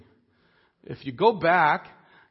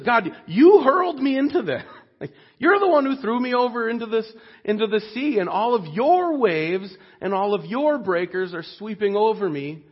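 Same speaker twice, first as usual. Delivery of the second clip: higher-pitched, anguished appeal to God; raised, overwhelmed plea, easing off at the end; impassioned outcry; 6 semitones higher than usual. Acted. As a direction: cry out to God as one being swept under. Jonah crying out to God.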